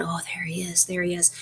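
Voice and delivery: soft crowd murmur, hushed; murmured aside. Retold or imitated. Crowd murmuring as Dimmesdale emerges.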